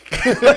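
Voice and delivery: sudden high delighted cackle; high giddy vampire cackle; gleeful laugh. Retold or imitated. Mimicking energy vampire glee.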